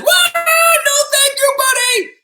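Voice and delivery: very high, loud alarmed shriek; surprised scream. Acted. Octopus squeezed by grizzly bear, surprised scream. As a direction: shriek it in comic shock. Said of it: "He was totally surprised".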